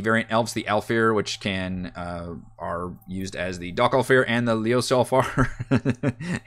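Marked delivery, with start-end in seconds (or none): 4.5-6.4 slow, laboured attempt at "Leoselfar"; struggling pronunciation. Real, not acted. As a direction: sound out "Leoselfar" unsurely, breaking into a laugh. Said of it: "Hate pronouncing those words".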